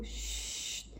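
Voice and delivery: sharp unvoiced hush; shushing. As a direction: cut him off with a quick shush. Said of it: "l'interrompit Hagrid". (Hagrid fait taire Ron : « Chut ! » soufflé)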